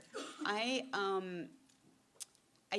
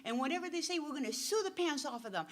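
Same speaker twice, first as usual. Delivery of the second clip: fired-up lawyer; 6 semitones higher, 4 dB louder, faster than usual. Retold or imitated. Lawyer promising to sue.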